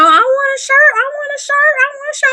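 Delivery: high, eager, repeated pleading; family begging. Retold or imitated. Family members begging her for a shirt, high and eager. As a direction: squeak it out like eager relatives. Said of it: "they was like".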